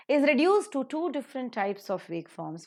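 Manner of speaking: ordinary talk, nothing marked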